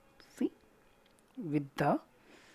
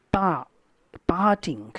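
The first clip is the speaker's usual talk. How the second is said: "Pa, parting" with the first syllable long and louder; stress on PA; 4 dB louder than usual. Shown as a demonstration. Teacher showing which sound is stressed, "parting" stressed on its first syllable. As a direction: lean on "Pa" in "parting". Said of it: "it's longer sound that is to be stressed".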